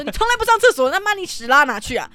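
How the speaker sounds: loud high exasperated yell; dad yelling. Retold or imitated. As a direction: shout it like a fed-up son. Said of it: "我爸超暴气". (大声怒吼学爸爸)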